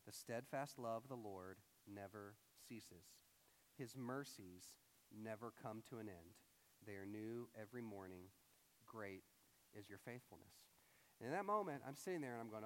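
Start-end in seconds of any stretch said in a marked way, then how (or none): 0.1-10.6 hushed, measured recital of scripture; scripture reading. Acted. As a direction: read the verse quietly with long pauses. Reads scripture aloud.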